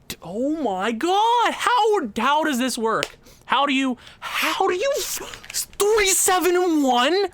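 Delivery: high, slow, incredulous; can't believe the record, voice climbing; stunned disbelief. Real, not acted.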